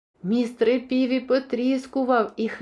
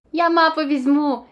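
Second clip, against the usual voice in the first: louder, higher and eager; perked up; 5 semitones higher, 6 dB louder than usual. A narrator's reading. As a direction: brighten up, suddenly keen. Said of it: "збадьорився Тімі".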